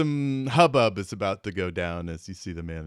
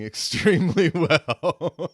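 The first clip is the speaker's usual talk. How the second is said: chuckling as the words come out; can't hold back the laugh while saying it; laughing through words; 8 semitones higher, 9 dB louder, slower than usual. Real, not acted. Game master to the table, laughing mid-line. Player cracks up mid-sentence.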